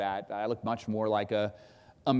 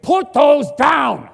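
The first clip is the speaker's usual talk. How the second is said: retold scream; 14 semitones higher, 13 dB louder than usual. Reporter screaming at teen militia to drop weapons, furious shout. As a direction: roar it like you've had enough. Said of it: "I scream".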